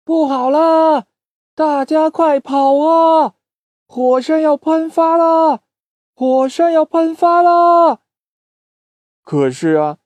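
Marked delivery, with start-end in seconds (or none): 0.0-8.0 high, loud, slow shouting; shouting warning. Acted. Little chick shouting to the street to flee, high and loud. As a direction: yell to the whole street to run. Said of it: "喊着".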